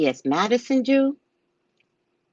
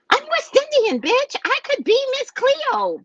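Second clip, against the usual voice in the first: defiant hype. Real, not acted. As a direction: boast with sass. Defiant claim to be psychic.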